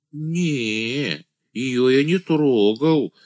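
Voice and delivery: высокий протяжный голос юродивого; простодушно отнекивайся, растягивая слова; блаженный «ангел», отнекивается. A narrator's reading. (юродивый, нараспев)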